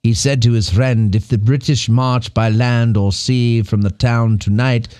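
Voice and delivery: grand British verse reading, falling low at line ends; British accent. Acted. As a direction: recite the poem in a put-on British accent. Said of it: "Why is he British?".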